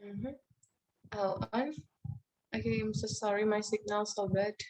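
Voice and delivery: usual speaking voice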